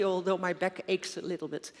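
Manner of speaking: usual speaking voice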